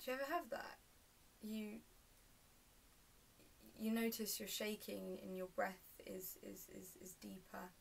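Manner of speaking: hushed, hesitant and halting; quiet confiding. Acted. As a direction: fade almost to a whisper, stumbling on the words. Monologue, describing shaking and breath.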